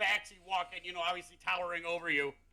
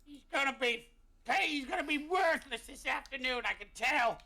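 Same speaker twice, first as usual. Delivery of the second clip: high-pitched exasperated character voice; high, put-upon complaint; Big Jim; 6 semitones higher than usual. Acted. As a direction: grumble pitched well above your own voice. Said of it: "Big Jim's like".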